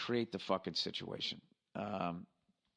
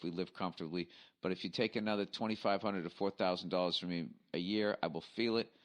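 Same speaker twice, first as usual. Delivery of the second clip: slow, deliberate read-out; reading email aloud. Acted. Host reading a listener's election email aloud, measured read-out. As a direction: read a listener's letter word by word, slowly.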